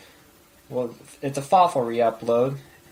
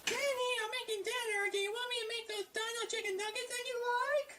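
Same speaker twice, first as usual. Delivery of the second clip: much higher, softer mom voice; 16 dB quieter, faster than usual. Pretend. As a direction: call out sweetly like a mom. Playing mom calling from kitchen.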